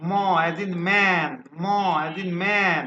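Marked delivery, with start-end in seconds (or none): 0.1-1.3 drawn-out, clear "ম as in man"; slow model pronunciation. Shown as a demonstration. Teacher modelling the sound for students, slow and clear. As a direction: stretch "man" slowly for the class to copy. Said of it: "এরপরে আমরা যেটা শিখবো".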